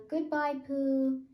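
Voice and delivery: high, loud farewell call; calling goodbye. A narrator's reading. Kanga calling goodbye to Pooh, high and loud. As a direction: call it out as she bounds away.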